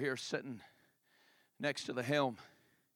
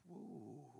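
hushed amazement; 15 dB quieter than usual. Acted. Disciples shocked.

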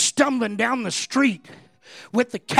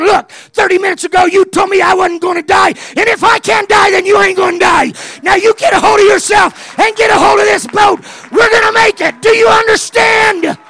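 full-throated shout, much higher and louder; screaming at brother; 11 semitones higher, 17 dB louder than usual. Retold or imitated. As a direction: roar at him to hold on. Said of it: "I started screaming at him".

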